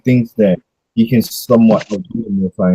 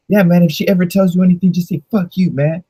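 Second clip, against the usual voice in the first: mock Esau kid; 7 semitones higher than usual. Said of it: "He went to Jake and he's like".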